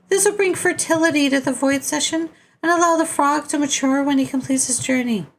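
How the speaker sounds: very high put-on voice giving instructions; high-pitched otherworldly character. Character briefing players on the frog's journey, high put-on voice. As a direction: pitch it far up, sustained and steady.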